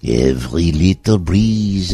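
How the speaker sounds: put-on slow, drawn-out French accent. Host shows off the French accent to his co-host, slow and drawn out. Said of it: "it's French accent is nice".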